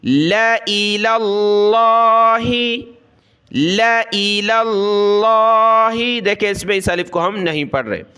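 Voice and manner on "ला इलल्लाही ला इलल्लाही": slow drawn-out recitation of इलल्लाही; Quranic recitation. Shown as a demonstration. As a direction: recite इलल्लाही slowly and melodiously, the alif left unread. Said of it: "इनको हमने नहीं पढ़ना".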